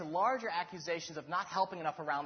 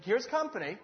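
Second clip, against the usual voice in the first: ordinary talk, nothing marked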